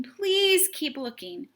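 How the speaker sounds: slow, high, breathy plea; boy pleading with the fisherman. A narrator's reading. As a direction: beg them to keep going.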